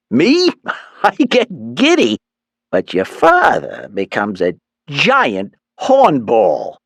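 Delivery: high, slow giggling mother's voice; giddy Mom. A narrator's reading. Mother confiding to son, high and giggly. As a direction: laugh through it like a giddy mom.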